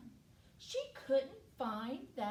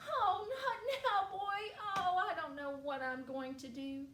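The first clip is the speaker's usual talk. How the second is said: high wail sinking into despair; flustered little girl; 8 semitones higher than usual. Acted. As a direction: fret aloud, losing steam as it goes. Upset girl brushes off puppy.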